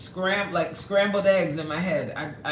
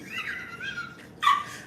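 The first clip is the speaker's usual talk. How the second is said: squeal laugh. Real, not acted. Laughing at Supreme.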